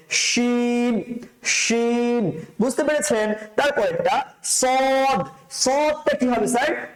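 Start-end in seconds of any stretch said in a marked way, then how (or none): 0.1-2.3 "শিন" with a full sh and long held ee; mouth-filling SH. Shown as a demonstration. Teacher modelling the letter, full-mouthed "শিন". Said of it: "মুখ ভর্তি করে আওয়াজ হবে।". 4.4-5.3 "সদ" with a heavy, rounded s and o; thick heavy S. Shown as a demonstration. Teacher modelling the letter, thickly pronounced "সদ". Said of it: "সদটা মোটা করে উচ্চারণ করতে হবে।".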